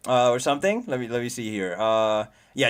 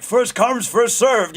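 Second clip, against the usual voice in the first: higher, louder cocky boast; Inosuke's bravado; 9 semitones higher, 6 dB louder, much slower than usual. Retold or imitated. Streamer voicing Inosuke rushing in, cocky. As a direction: puff up like a reckless boar fighter. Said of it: "thinking, like".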